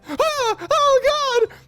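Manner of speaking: a high, loud yelp of shock; startled oh God; shocked cry. Real, not acted. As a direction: react in horror. Shocked outburst.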